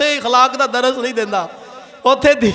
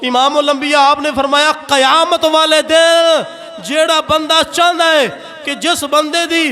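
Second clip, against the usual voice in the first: raised, ringing sermon voice; impassioned preaching; 4 semitones higher than usual. Real, not acted. Preaches with rising force. (पुरजोश तकरीर, उच्ची आवाज़ विच)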